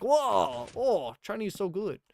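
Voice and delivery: high, loud mock amazement; impressed locals. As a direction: wow at a foreigner's Chinese. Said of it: "they're like".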